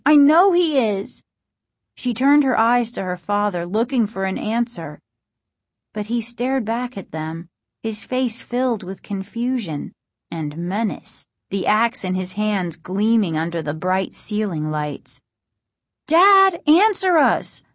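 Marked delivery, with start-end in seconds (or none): 0.1-1.2 put-on high, louder, adamant kid; insistent. A narrator's reading. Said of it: "Margaret insisted". 16.1-17.6 high, loud, urgent demand; insistent high-pitched girl. A narrator's reading. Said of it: "Margaret demanded".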